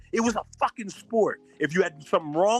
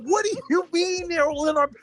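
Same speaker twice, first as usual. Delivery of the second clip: exasperated disbelief; 7 semitones higher than usual. Retold or imitated. Kid arguing with paranoid mother, high and incredulous. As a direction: protest like a kid arguing back, laughing at We are poor. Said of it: "I'm like".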